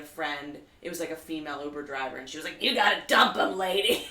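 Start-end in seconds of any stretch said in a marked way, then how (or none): 2.6-4.1 loud, emphatic tough-love call; loud retold advice. Driver telling her to dump him, loud and emphatic. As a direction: bark it like a blunt cabbie.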